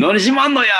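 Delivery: high, loud Osaka comedian retort; Shimaki's catchphrase. Retold or imitated. Retelling the toilet story to the cohost, comedian's indignant retort. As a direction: snap it like the old comedian caught off guard. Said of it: "って言ったっていう".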